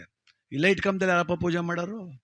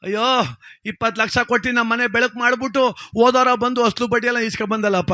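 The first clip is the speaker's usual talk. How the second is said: loud hammed-up wailing; mock lament; 5 semitones higher, 5 dB louder than usual. Borrower mourning his moneylender, loud mock lament. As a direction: cry out in exaggerated grief. Debtor acted lamenting over corpse.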